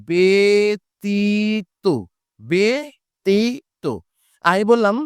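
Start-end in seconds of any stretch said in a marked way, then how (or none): 0.1-3.8 ব্যতীত stretched syllable by syllable, the ব্য said "be"; drawn-out pronunciation demo. Shown as a demonstration. Teacher to students, stretching out ব্যতীত very slowly. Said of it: "তাহলে শব্দটা হবে কি".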